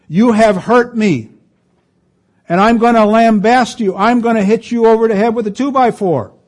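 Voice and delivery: mock furious grudge-holder; angry accuser. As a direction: rant at the offender.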